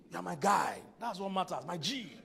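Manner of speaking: loud, laughing bro-to-wife declaration; hyped guy voice. Pretend. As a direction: play the buddy who means it. Man voicing his own devotion.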